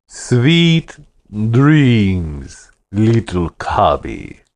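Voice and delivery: slow, sing-song fake sweetness in a Russian accent; sickening false sweetness. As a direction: croon it with menacing sweetness. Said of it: "sang the words with a sickening false sweetness".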